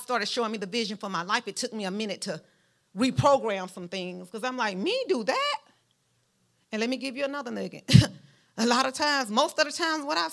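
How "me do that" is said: high-pitched incredulous question; mock disbelief. Preacher reacting to God's vision for her, mock disbelief.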